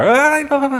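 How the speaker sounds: high, hammed-up panicky ah ah ah; preacher acting out sinking Peter, mock panicked yelps; mock panic cries. Short high yelps of pretend panic. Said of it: "and panic".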